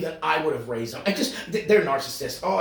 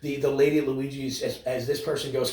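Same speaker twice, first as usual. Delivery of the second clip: ordinary delivery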